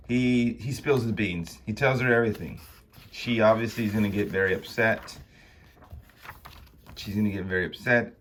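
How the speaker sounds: usual speaking voice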